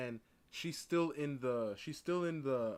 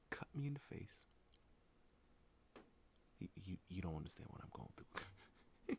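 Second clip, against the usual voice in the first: hushed confession; 12 dB quieter than usual. Pretend. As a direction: lean in and whisper the grim details. Imagined confiding to bystander.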